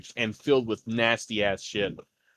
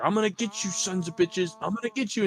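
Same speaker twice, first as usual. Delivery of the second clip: higher mock threat, a hammed-up raider yell; 7 semitones higher, faster than usual. GM voicing a raider yelling threats at the party, hammed-up. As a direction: taunt them from around the corner, pitched up. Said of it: "You hear him start yelling".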